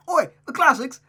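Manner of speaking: loud, high mock-British accent; Gavin Free impression. Reader trying a new accent for the girl, mock-British. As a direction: shout it in a hammed-up British accent. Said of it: "give her that type of accent".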